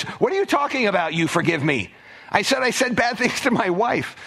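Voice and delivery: high-pitched, incredulous protest; indignant disbelief. Acted. Congregant objecting to the pastor's forgiveness, indignant disbelief.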